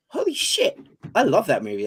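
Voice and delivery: sudden high Holy shit; surprised delight. Retold or imitated. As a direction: burst out in surprise. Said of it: "I was like".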